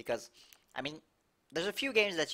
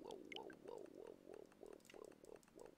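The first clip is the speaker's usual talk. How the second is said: mock dying moan; 26 dB quieter, faster than usual. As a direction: sink into a low hammed-up groan. Dramatic low dying groan.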